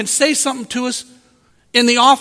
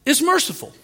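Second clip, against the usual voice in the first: ordinary talk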